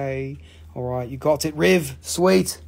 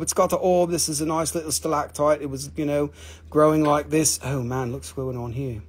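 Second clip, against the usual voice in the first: ordinary talk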